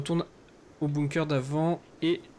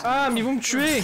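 panicked cry; 8 semitones higher, 4 dB louder than usual. Real, not acted. Under attack in combat.

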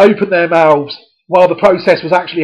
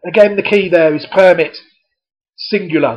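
usual speaking voice

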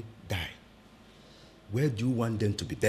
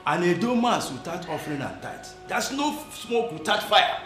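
loud proverbs; 3 semitones higher, 7 dB louder than usual. Acted. Man scolding Mary with proverbs, voice raised. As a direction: hammer the saying home.

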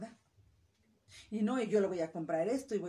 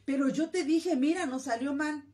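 loud, higher told-you-so; blaming outburst; 4 semitones higher, 7 dB louder than usual. Retold or imitated. A wife blaming her husband, loud reproach. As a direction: snap the blame at them. Blaming family when things fail.

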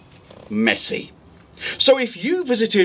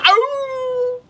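high crying howl; wolf howl. Acted. Wolf leaving the house, sad howl. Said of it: "he howled".

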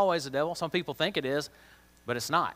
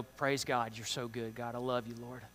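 hushed, softer mock-thankful prayer; mock praise prayer; 5 semitones lower, 12 dB quieter than usual. Kneeling kid mocking praise after loss, hushed. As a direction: murmur it like a too-perfect prayer. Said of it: "get on your knees and go".

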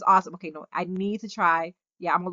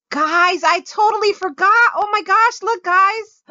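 much higher and louder, bursting with excitement; excited squeal; 11 semitones higher, 6 dB louder than usual. Real, not acted. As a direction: gush to the viewers about a great find. Suddenly discovers forgotten items.

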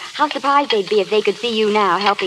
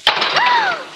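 high sharp gasp; dismayed gasp. Acted. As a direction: catch your breath in dismay. Another accident in the kitchen.

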